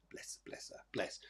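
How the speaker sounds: a soft aside to herself, barely there; fond whisper. Real, not acted. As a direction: breathe it out, unvoiced and tender. Reader fondly on loud spirit.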